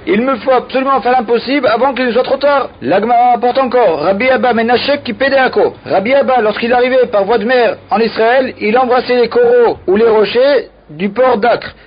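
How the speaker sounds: usual speaking voice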